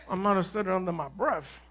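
sheepish mock mumble; mock muttering. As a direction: mutter it like a guilty excuse. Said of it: "The person wants to be able to say".

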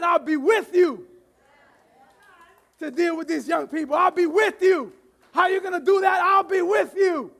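preacher booming God's reassurance; full-force preaching. Acted. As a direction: proclaim it to the whole room.

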